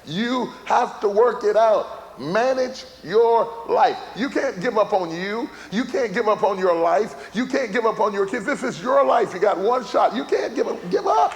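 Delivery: emphatic, high-pitched preacher climbing to a straining shout; full-force preaching. Real, not acted. Preacher urging listeners not to quit on life, impassioned shout. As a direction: drive each exhortation home with rising fervour.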